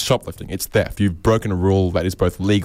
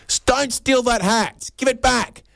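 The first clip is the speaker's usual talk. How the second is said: much higher, slow scolding lady voice; nagging bystander. Retold or imitated. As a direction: scold the thief in a shrill-pitched mock lady. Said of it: "a lady behind her saying".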